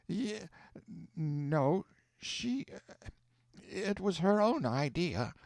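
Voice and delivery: a nervous aunt covering a lie; flustered fib. Acted. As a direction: trip over the words hiding the truth. Hannah stumbles over a lie.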